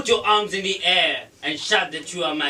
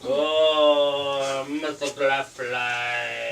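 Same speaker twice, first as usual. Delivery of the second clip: lovestruck; 5 semitones lower, much slower than usual. Acted. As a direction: melt into a dreamy sigh. Lovestruck after potion.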